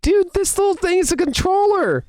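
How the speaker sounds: very high, gushing disbelief; amazed outburst. Retold or imitated. Player marvelling at the tiny Joy-Con, high-pitched and astonished. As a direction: squeal it in hammed-up amazement.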